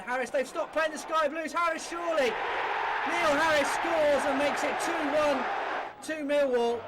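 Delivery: can't believe the defence stopped; excited goal call. Real, not acted. Millwall score.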